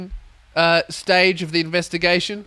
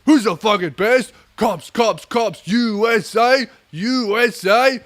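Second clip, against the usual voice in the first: chanting victory cheer, raised pitch; celebrating cops; 6 semitones higher, 4 dB louder, slower than usual. Acted. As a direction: chant it like a rowdy winning crowd. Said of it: "having a fucking celebration going".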